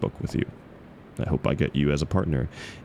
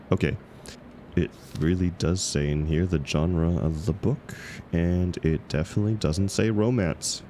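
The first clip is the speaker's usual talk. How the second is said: ordinary delivery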